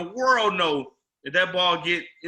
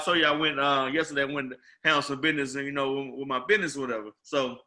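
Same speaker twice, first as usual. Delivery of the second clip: ordinary delivery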